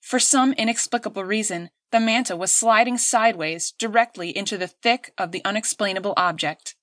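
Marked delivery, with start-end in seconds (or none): none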